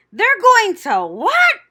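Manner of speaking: high, slow, shouted disbelief; screamed. A narrator's reading. Pig Wilbur screams on hearing he'll be killed. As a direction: scream the question in shock. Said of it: "screamed Wilbur".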